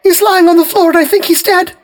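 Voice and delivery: high, loud sobbing words. A narrator's reading. Wife sobbing to the police, high and loud. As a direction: sob it out. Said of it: "she sobbed".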